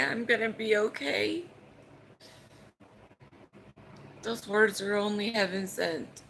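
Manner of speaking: fighting back tears while telling about mom's call; choked up, emotional. Real, not acted. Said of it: "I'm sorry if I get emotional".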